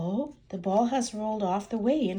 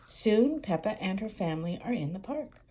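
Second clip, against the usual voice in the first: ordinary delivery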